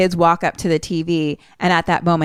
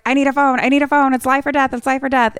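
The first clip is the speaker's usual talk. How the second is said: high, urgent retold pleading; mock frantic begging; acted desperate plea; 7 semitones higher, faster than usual. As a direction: plead it higher, repeating with urgency. Acting out desperate man's plea.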